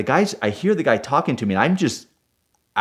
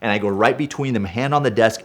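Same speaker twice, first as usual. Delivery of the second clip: normal talking voice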